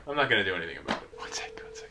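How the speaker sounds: fast unvoiced whisper. Real, not acted. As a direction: hush it under your breath. Whispering in the dark.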